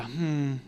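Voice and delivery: soft disappointed hum; quiet mock-dissatisfied "hmm"; disapproving sigh. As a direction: let out a small pointed sigh. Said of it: "sighs that let her know".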